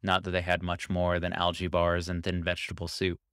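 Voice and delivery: ordinary delivery, nothing marked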